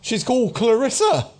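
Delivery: high-pitched ranter's voice; haunted doomsayer. Acted. Dishevelled man asked about his devil, high doomsayer voice. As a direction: confide your devil's name in a high voice.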